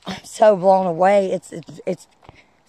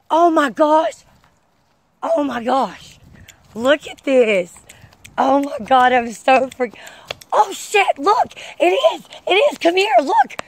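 excited discovery; 6 semitones higher than usual. Real, not acted. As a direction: squeal it out like you just found buried treasure. Thrilled finding ancient stone walkway.

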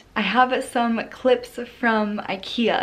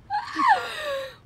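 high laughing shriek. Real, not acted. Laughing over cinnamon roll.

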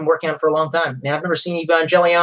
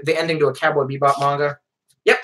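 usual speaking voice

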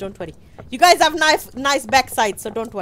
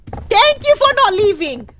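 high, loud grateful cry; shouted thanks; 8 semitones higher, 7 dB louder than usual. Real, not acted. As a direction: call out thanks at full voice. Relieved shout to other players.

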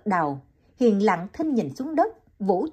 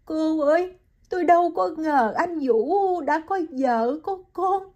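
choked up; 8 semitones higher than usual. Acted. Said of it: "Hiền nghẹn ngào bảo Liễu".

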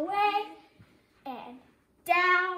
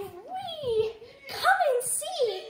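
excited call; 7 semitones higher, slower than usual. A narrator's reading. Frog calls out in story.

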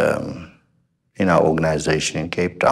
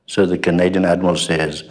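ordinary talk

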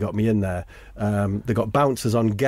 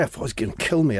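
ordinary talk

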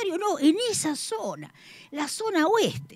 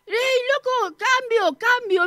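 higher, calling out; shout; 8 semitones higher, slower than usual. Retold or imitated. Woman yelling to the scrap collector, higher. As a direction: yell across the street to the junk man. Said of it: "Le grito".

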